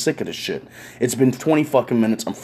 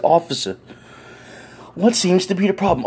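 put-on a lightly raised elderly lady's voice; old lady voice; 7 semitones higher than usual. Elderly neighbour asks the officer about the problem, lightly voiced. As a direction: voice her like a proper older woman.

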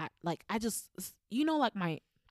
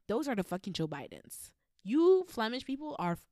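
normal talking voice

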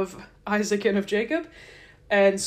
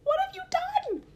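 mock Laban outrage; 9 semitones higher than usual. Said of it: "And so he says".

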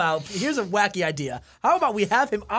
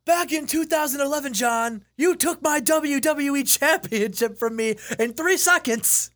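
hammed-up rematch challenge; mock wrestler promo; 7 semitones higher, 3 dB louder than usual. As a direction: confront him like a wrestler on the mic. Voicing CM Punk confronting Cena.

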